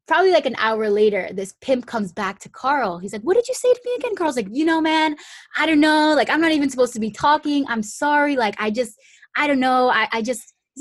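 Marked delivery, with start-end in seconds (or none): 3.2-4.1 high, pressing demand; imitating the pimp. Pimp returns asking Carl. 4.5-10.5 higher, louder, fast flustered babble; nervous rambling. Retold or imitated. Carl flustered, freaking out.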